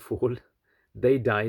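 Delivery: plain reading voice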